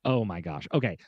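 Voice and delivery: ordinary talk, nothing marked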